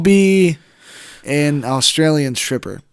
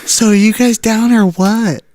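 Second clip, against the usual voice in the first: higher put-on hostess voice; English stripper; 5 semitones higher than usual. Stripper pressing customers to decide, higher put-on voice. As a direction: press them in a high stripper voice. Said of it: "I'm just an English stripper".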